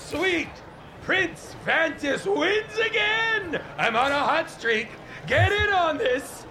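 high, crowing unhinged gambler; Sweet Prince Vantis. Acted. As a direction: crow a win wildly from across the room. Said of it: "This unhinged voice".

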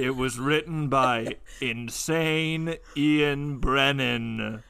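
spooky announcer. Pretend. Spooky slow credits.

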